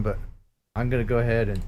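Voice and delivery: usual speaking voice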